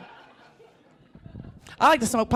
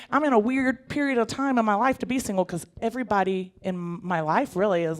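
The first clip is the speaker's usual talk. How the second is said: ordinary delivery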